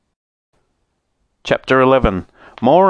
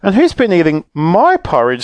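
high, indignant mother bear; 8 semitones higher, 3 dB louder than usual. A narrator's reading. Mother bear finds the porridge eaten, indignant. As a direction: echo the complaint, leaning on "my". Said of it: "said the mother bear".

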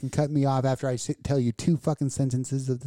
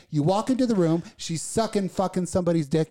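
ordinary delivery

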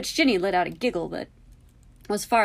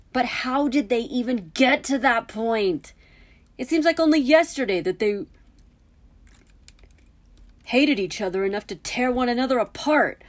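loud, higher baffled protest; exasperated disbelief; 6 semitones higher, 6 dB louder than usual. A narrator's reading. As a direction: argue it hotly. Harry argues in disbelief.

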